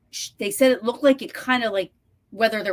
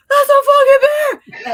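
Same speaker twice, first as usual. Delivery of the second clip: much higher, loud panicked yell; 12 dB louder than usual. Retold or imitated. Reliving spotting the bear to the co-host, a panicked yell. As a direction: scream it out in terror. Terrified retold outburst.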